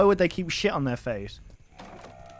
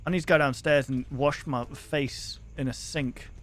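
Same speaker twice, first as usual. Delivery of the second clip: ordinary talk, nothing marked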